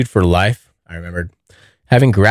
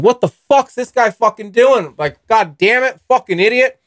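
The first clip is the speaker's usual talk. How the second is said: high, mock angry yelling; road rage rant; 11 semitones higher than usual. Imagined road rage at the U-turning driver, high mock yell. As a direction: rant at the other driver, pitched up. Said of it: "I'd be like".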